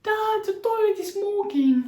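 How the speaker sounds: put-on high, slow, drawn-out alarmed child; panicked kid quote. Kid telling dad the bin smokes, high and alarmed. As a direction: wail it like a kid calling his dad. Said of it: "Est-ce que vous avez la ref?".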